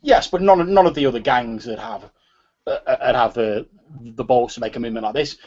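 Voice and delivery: agitated gang member. Acted. Gang member protests to a rival that nobody would do this, agitated. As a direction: argue it hotly, tripping over the words. Game master voices indignant character.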